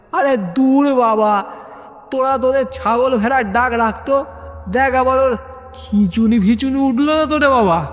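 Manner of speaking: high, impatient worried voice; exasperated friend. Acted. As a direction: cut them off, half worried. (অধৈর্য উদ্বিগ্ন বন্ধু)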